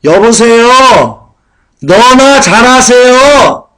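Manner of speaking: loud, very slow, high mocking jab; sarcastic retort. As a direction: drag it out to sneer at the warner. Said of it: "그리고는 비꼬입니다".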